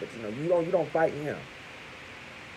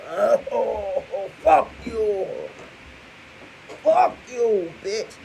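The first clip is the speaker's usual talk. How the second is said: high, loud, drawn-out cussing from a man on his last breath; hammed-up defiant cursing; dying man cussing; 8 semitones higher, 5 dB louder, much slower than usual. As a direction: spit the curses out like a man knocked out cold. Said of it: "he's gonna be like".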